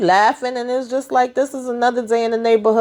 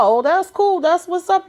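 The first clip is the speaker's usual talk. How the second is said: high, bright mock praise; 9 semitones higher than usual. Duda praising him in a high voice. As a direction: pitch it way up as Duda, impressed.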